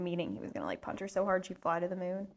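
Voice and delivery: ordinary delivery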